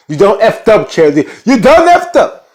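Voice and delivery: loud, high-pitched mock scolding, stretched on the repeat; mock scolding shout. Lady Mae telling Charity she effed up, mock scolding. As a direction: act out a rising, indignant telling-off.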